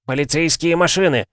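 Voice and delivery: high announcing shout. A narrator's reading. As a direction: call it out over a noisy company. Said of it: "повысив голос, обратился его отец". (громкий окрик отца)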